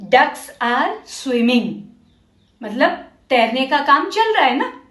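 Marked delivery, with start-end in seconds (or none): 0.1-1.7 each word said very slowly; slow model sentence. Shown as a demonstration. Teacher models the sentence very slowly for the class. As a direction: spell it out slowly for children. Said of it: "तो हम कहते हैं".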